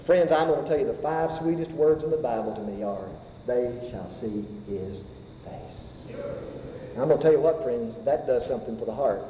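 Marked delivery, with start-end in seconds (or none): 3.5-6.2 hushed awe, fading to breath. Acted. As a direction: drop to a near whisper on face. Climactic hushed scripture quote.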